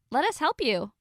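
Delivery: put-on chirpy popular girl; eager.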